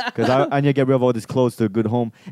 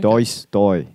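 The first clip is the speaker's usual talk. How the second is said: low put-on Aussie catchphrase; mock Australian, pitched down; Australian accent; 6 semitones lower than usual. Shown as a demonstration. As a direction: do a deep, attempted Australian "Dois, doi".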